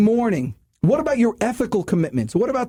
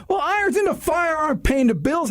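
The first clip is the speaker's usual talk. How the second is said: raised, much higher voice, shouting the point; real yelling; 7 semitones higher than usual. Real, not acted. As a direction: yell it at the listeners in frustration. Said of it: "I don't know why I'm yelling at you".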